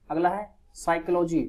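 normal talking voice

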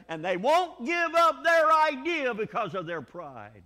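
high, loud, slow preaching; slow, loud, high-pitched sermon climax; preaching at full force. Real, not acted. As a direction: drive it home from the pulpit.

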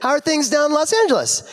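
high, sweet, innocent small talk; Osric impression. Imitating Osric's deceptively sweet high chatter. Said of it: "in all like".

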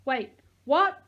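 loud startled holler; boy hollering in confusion. A narrator's reading. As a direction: yell it out in surprise. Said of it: "I heard Max holler".

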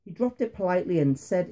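ordinary delivery

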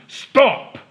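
angry giant bellowing stop; roaring giant. Acted. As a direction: roar at the fleeing girl. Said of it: "roared the giant".

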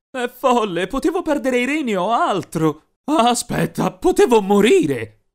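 high, loud rising alarm; building panic to a shout; shaken outburst. Acted. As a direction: realize the danger aloud. Realizes he nearly died.

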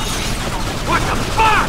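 breathless shocked gasp of a curse. Acted. Lynch stunned at the attack, breathless shock.

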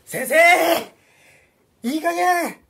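calling out. Acted. Guide calls out to teacher.

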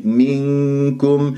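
منكم with a nasal nun before the kaf; hidden nun. Shown as a demonstration. Teacher reciting the word slowly. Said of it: "صفي النون مع الكاف".

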